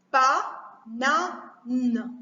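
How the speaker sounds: "banane" slowly as ba... na... ne; three separate beats in "banane"; split into syllables. Shown as a demonstration. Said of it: "couper les mots en syllabes".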